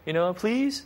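sweetly asking, rising at the end; gentle coaxing. Retold or imitated. Father coaxing toddler to give it.

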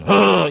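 sharp nasal snort through the nostrils; horse snort. Shown as a demonstration. Preacher demos an angry horse snort. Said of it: "this kind of sound".

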